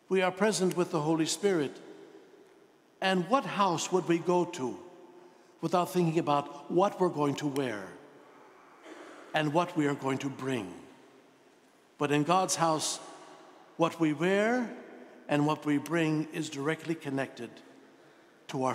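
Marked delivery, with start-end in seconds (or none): none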